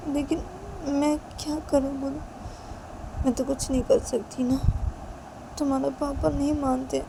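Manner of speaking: put-on a young mother confiding in her baby son; mother talking to baby. Mother tells her baby son not to be like papa, tenderly.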